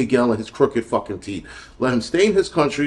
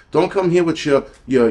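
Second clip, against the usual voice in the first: ordinary talk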